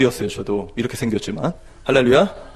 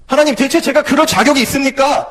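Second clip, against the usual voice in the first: pleading cry; 11 semitones higher, 5 dB louder than usual. Retold or imitated. Crying out to God.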